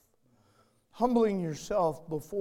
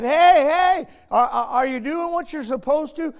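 loud, high, urgent call; God calling out; 10 semitones higher, 14 dB louder than usual. Retold or imitated. God stopping the pastor in his tracks, loud call. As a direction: call him back into line, loud. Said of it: "God said".

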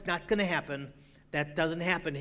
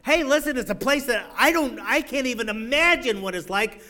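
rising, ever louder, higher preaching; preaching climax; 6 semitones higher, 7 dB louder than usual. Acted. Preacher rousing his congregation about heaven, building to a shout. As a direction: build the praise toward a shout.

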